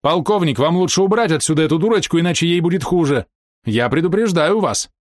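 high, menacing warning; threatening warning. A narrator's reading. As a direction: warn them off, slowing at the end. Фрэнсис угрожает полковнику. (угрожающее предупреждение)

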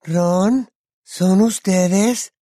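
put-on high, slow, airy girl's voice; loud stage whisper, pitched high. A narrator's reading. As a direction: ask tentatively, drawing the words out. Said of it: "se oyó susurrar en la oscuridad".

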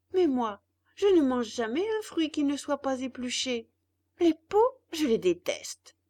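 a hungry puppet complaining to his father; puppet boy voice. Acted. Puppet refuses skins.

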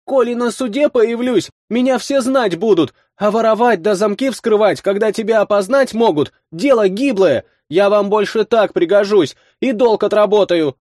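put-on high, earnest girl voice; pleading girl voice. A narrator's reading. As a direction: plead your case as a young girl. Girl bargains tearfully.

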